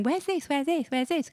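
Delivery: high, mock-flustered repeated question; high-pitched pretend fluster; mock frantic searching. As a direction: imitate hunting round for lost things. Mimics her own frantic searching.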